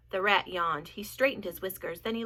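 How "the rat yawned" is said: usual speaking voice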